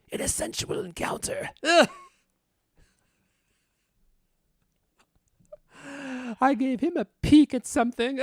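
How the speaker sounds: high breathy swoon; coy high lady voice. Acted. Voicing Missus D's lines.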